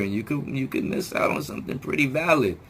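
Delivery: normal talking voice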